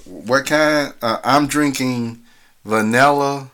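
ordinary delivery

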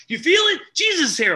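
high, loud cry of rapture; hammed-up revival holler; ecstatic church shout. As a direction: shout it like the spirit hit you.

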